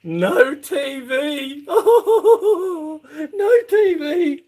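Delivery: very high, drawn-out mock wail. Speaker playfully laments having no TV, mock wailing. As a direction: wail it slowly in a sustained high voice.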